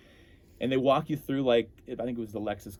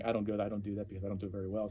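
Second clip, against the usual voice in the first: ordinary delivery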